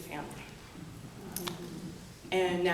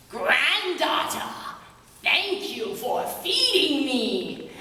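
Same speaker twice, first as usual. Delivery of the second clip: a huge creature speaking gently; giant's voice; 5 semitones higher than usual. A narrator's reading. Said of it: "until he said".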